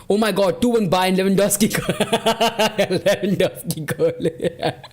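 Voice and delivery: goal call breaking into real laughter; delighted laughing. Real, not acted. Streamer reacting to a Lewandowski goal, delighted laughing. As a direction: laugh helplessly through the words. Goal scored during watchalong.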